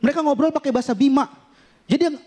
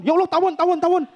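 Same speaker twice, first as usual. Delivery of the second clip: higher, hammed-up panicky cry; mock panic; 7 semitones higher than usual. Comic mocking Jakarta people facing one wasp, acted panic. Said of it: "paniknya minta ampun".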